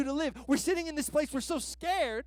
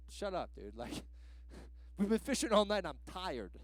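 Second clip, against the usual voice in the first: low, soft, fed-up grumble; tired fishermen answering a stranger, grumbling; grumpy tired fishermen; 4 semitones lower, 7 dB quieter than usual. Retold or imitated. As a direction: mutter it like a worn-out fisherman. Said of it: "They're like".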